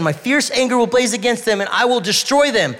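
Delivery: angry God. Acted. Voicing God's threat loudly.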